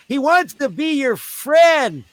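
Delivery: put-on high, slow child's voice; imitating a kid. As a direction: coax it like a child explaining. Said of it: "He goes".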